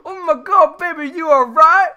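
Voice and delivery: high, very loud Australian yelling in alarm; panicked Australian. Retold or imitated. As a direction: impersonate a shrieking Australian bloke at full volume. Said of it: "That's the most Australian it can possibly get".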